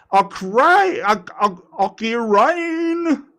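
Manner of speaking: stumbling into a high drawn-out announcer call. Pretend. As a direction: stretch the name high after false starts. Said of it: "announcer voice going".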